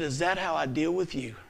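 soft, restrained rebuke; the Lord. Retold or imitated. The Lord checking an angry father, quiet reproach. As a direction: question gently and quietly. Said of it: "and the Lord said".

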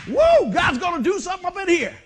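loud, high whoop that rolls on into laughing praise; laughing excited shout. Retold or imitated. Pastor retelling his reaction to missed cues, laughing loudly. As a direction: shout it out with a laugh, full of joy. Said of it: "What I do, I just laugh".

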